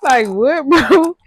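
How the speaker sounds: can't believe he said it, laughing; squealed, slow what-the-freak through a laugh; laughing shock. Retold or imitated. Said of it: "I said".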